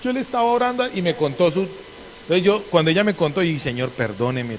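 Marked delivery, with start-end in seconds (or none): 0.0-0.9 humble church sister. Retold or imitated. Church sister answering the preacher's offering, humble high voice. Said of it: "con humildad me dijo". 3.6-4.6 low, soft retold apology to God; contrite prayer. Preacher retelling his apology to God, low contrite voice.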